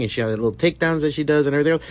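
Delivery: ordinary talk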